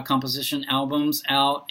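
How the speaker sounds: ordinary talk, nothing marked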